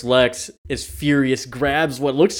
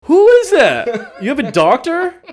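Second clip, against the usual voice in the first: high, louder mock confusion; mock Clark; 13 semitones higher, 6 dB louder than usual. Clark asking Lex who the doctor is, high mock squeak. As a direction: squeak out baffled questions. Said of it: "He's like".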